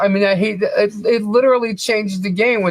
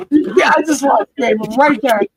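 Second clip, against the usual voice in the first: exclaiming; 5 semitones higher, 3 dB louder than usual. Real, not acted. Reacts loudly to reading.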